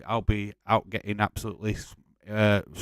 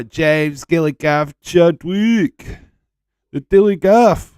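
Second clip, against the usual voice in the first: loud, high, drawn-out name call; shout-out; 7 semitones higher, 12 dB louder, slower than usual. Acted. Streamer hailing a viewer in the chat, big announcer call.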